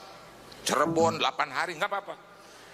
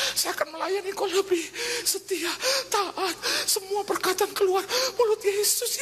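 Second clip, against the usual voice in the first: desperate pleading prayer; 14 semitones higher than usual. Retold or imitated. Preacher reenacting his tearful bargaining prayer. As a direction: bargain with God in tears.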